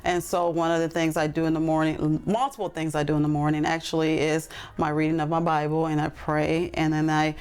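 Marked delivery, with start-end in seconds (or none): none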